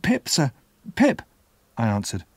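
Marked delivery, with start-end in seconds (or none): 0.0-1.2 put-on high-pitched timid boy; small, high, scared reply; frightened child. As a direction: answer nervously in a child's voice. Frightened boy answers the convict.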